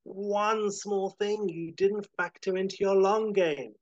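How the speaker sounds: high, loud dramatic hero's speech; defiant hero. Acted. Howard tells Chet what he didn't factor in, defiant. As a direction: build to the twist, stretching the words.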